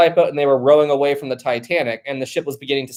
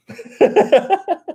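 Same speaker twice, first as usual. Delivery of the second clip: high burst of real laughter; laughing to the point of tears; tearful laughter. Real, not acted. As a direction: crack up all over again. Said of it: "actually tearing up a bit".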